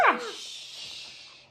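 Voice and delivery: high, breathy unvoiced splash; whispered splash. The tyrannosauruses falling into the river, a breathy high splash. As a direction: let the splash hiss out. Tree bridge breaks, they fall.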